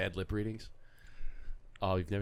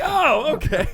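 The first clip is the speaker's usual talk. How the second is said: high squeaky okay cracking with laughter; stunned okay through laughter; 14 semitones higher, 11 dB louder, slower than usual. Retold or imitated. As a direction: play the shocked reporter while laughing. Reporter's stunned reply, laughing.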